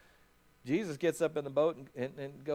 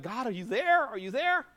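1 pleading to God; 8 semitones higher than usual. Acted. Pleading disciples voiced in sermon.

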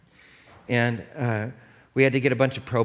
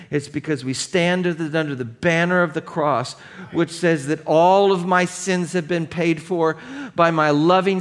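rising, louder, impassioned preaching that builds; preaching at full force; 8 semitones higher, 8 dB louder than usual. Real, not acted. Pastor urges the congregation with impassioned climactic preaching. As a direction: climb to a fervent, soaring climax.

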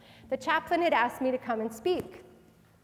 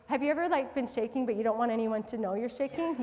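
ordinary talk

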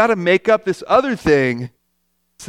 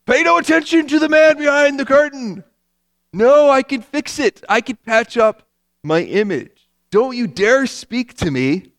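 proud insecure person; 7 semitones higher, 4 dB louder than usual. Retold or imitated. Prideful person protecting their image, hammed-up high and loud. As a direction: ham up the one hiding behind the image. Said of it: "this is exactly what we do".

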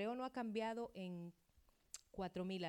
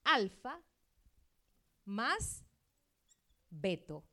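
"Alfa" punched loud, then "más", then "beto" spaced apart; splitting the word; 6 dB louder, slower than usual. Shown as a demonstration. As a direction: break "Alfa más beto" into separate beats, "Alfa" loudest. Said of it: "tiene dos palabras".

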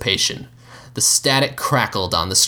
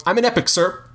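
blurted; 10 semitones higher than usual. Acted. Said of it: "I blurted".

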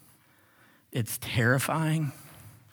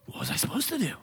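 soft, higher-pitched self-defense; mock helpless excuse; 5 semitones higher, 5 dB quieter, faster than usual. Aaron excusing himself to Moses, feigning helplessness. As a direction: plead innocence in a small voice.

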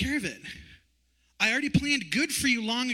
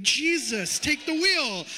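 exasperated plea; 6 semitones higher, 3 dB louder, much slower than usual. Retold or imitated. Exasperated after mailbox mishap.

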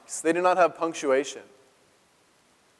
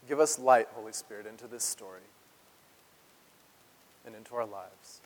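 prayer; 2 semitones lower than usual. Real, not acted. Prays over the text.

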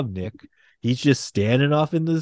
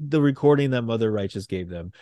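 ordinary talk, nothing marked

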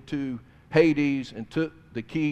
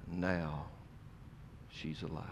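hushed awe; 9 semitones lower, 17 dB quieter than usual. Real, not acted. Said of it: "preacher hushes for effect".

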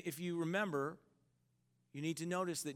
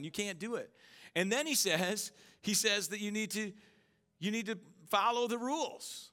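higher and louder, driving sermon voice; fervent preaching; 7 semitones higher, 6 dB louder than usual. Acted. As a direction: preach it hard to the congregation. Preaches at full force.

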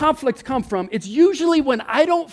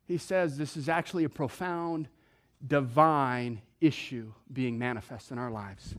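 much softer, lower reverent delivery; hushed preaching; 10 semitones lower, 7 dB quieter than usual. Acted. Preacher solemnly introducing the verse to the church. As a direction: draw them in with a quiet, solemn tone.

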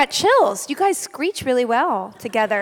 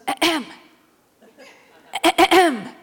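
higher, pointed throat-clearing "ahem"; imitated baby cough; 4 semitones higher, slower than usual. Mother shows how her baby wakes her, imitated cough. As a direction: mimic a baby's demanding little cough. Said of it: "She's literally coughs".

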